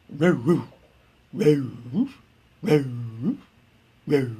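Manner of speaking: drawn-out Southern-accented woof; North Carolina dog bark. Shown as a demonstration. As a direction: bark like a golden retriever with a Carolina twang. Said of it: "golden retrievers from North Carolina bark like this".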